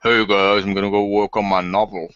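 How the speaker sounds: imitating a light North Carolina twang; North Carolina accent. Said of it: "Carolina accent of, like".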